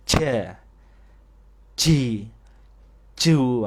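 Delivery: Ja, ji, ju ditekan, bernafas, lalu dibanting; logat Jawa. Shown as a demonstration. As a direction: contoh jim medok Jawa. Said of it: "Nah, ini logat Jawa". (jim logat Jawa yang keliru)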